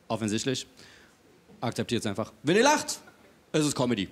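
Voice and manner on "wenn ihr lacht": LACHT shooting up high at the end; high rising tune on "lacht"; stressed rising condition. Shown as a demonstration. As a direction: swing "lacht" sharply upward, like setting up a rule. Mock lesson on comedy versus cabaret.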